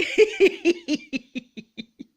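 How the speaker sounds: high loud burst of laughter. Real, not acted. Laughing loudly at the chaotic display. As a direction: let the laugh break out. Laughing at her own chaos.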